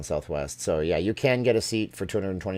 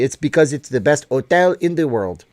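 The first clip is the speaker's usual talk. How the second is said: louder, exaggerated Quebecois accent; 6 semitones higher, 8 dB louder than usual. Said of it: "That's my [name]".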